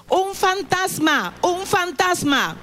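a touch of fright, drawn out and high; mild frightened cry. Acted.

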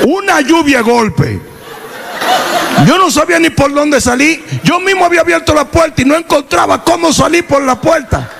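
high, laughing telling; laughing through the memory of a beating; high laughing recollection. Real, not acted.

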